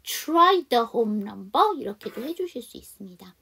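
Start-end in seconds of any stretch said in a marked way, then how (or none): 0.0-1.8 slow, loud "Try the home number" with weakened middle word; dropped H. Shown as a demonstration. Teacher shows H-less linking in "Try the home number". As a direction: link "Try the" with no H of "her". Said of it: "H 발음을 안 하고 D 발음을 또 넘겨주는".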